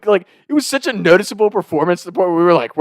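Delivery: normal talking voice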